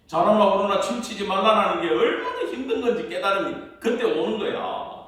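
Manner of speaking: loud, driving preacher's push; fervent preaching. Acted. Pastor urging the congregation to read scripture, preaching loud. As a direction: preach it out with full force to the congregation. Preacher raises to full force.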